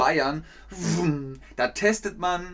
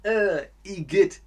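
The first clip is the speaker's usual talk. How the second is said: disgusted igitt; 4 semitones higher, slower than usual. Shown as a demonstration. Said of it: "dann ist es so".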